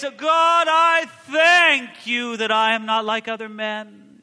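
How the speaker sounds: shouted high, slow, grandly showy; mock-grand booming Pharisee prayer; pompous showy prayer. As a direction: perform the prayer so everyone hears. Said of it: "There's something musical and beautiful about it".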